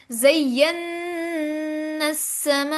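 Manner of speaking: slow chanted ज़य्यन्ना with a long hummed न, then long समा; ज़य्यन्ना समा drawn out in recitation; nasal ghunna. Shown as a demonstration. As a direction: hum the doubled न through the nose and stretch समा. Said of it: "नाक के बांस से".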